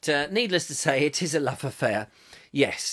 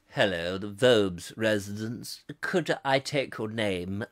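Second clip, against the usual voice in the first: low, slow, formal butler; 8 semitones lower, 3 dB quieter, slower than usual. Acted. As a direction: receive a caller as a stately butler. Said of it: "my butler might open the door".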